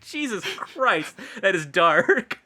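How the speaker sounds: slow, stunned, then a laugh; stunned disbelief breaking into laughter; shocked. Retold or imitated. Host reacting to the withdrawal scene, stunned. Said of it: "I was like".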